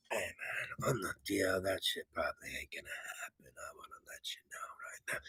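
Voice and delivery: secretive whisper to a friend. Retold or imitated. Year twenty twenty confides to a friend that plans fall through, whispered. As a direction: lean in and whisper the bad news.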